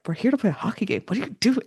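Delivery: half-laughing mock protest; mock exasperation. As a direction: imitate a baffled, exasperated player. Voicing Shane scolding flirty opponent.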